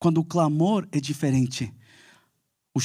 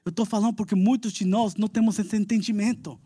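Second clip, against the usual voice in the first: ordinary talk, nothing marked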